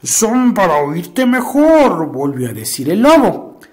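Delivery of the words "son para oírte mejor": very slow put-on granny voice; wolf hiding behind a granny voice; wolf faking grandma.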